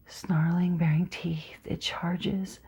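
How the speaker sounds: tense breathless whisper; hushed dread. Acted. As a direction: hold your breath as the beast charges. Tense attack described.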